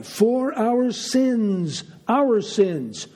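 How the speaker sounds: "our" drawn out slowly and pitched high, then repeated; stress on our. Real, not acted. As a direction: lean hard on the word "our". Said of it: "Why am I emphasizing that?".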